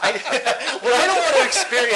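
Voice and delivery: talking through laughter; giggling mid-sentence; laughing. Real, not acted. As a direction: try to get the point out while laughing. Laughing at co-host's interruption.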